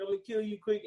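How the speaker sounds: high, whiny crying threat; mock crying threat. Retelling the chase scene, high mock crying. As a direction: sob it out like a crying gunman. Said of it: "He was crying the whole time".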